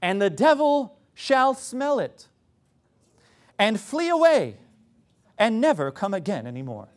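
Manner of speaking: sarcastically grand British scripture voice; pseudo-intellectual British accent. Acted. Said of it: "that's the pseudo-intellectual accent".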